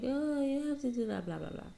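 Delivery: high, prissy imitation of people lecturing her; mock nagging people. People telling her what to do, mock high nagging. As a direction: mimic busybody advice, then trail off. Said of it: "people gonna be like".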